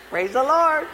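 high-pitched pious greeting; hammed-up praise. Father after hurrying kids to the food, hammed-up praise. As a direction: put on a showy praise.

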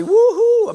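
high, loud "woohoo"; excited whoop. Acted. Churchgoer after men's ministry, whooping with delight. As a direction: cheer like a thrilled churchgoer.

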